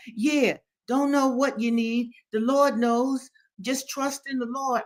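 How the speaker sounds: put-on high, insistent old man; exhorting Daddy Joe. A narrator's reading. Old man tells Henry to trust, exhorting.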